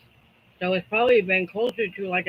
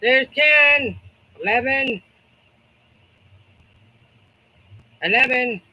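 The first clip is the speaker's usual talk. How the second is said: loud, high calling out the counts; auctioneer; 4 semitones higher, 5 dB louder than usual. Pretend. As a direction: call each number like a bidder's price. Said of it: "I feel like an auctioneer".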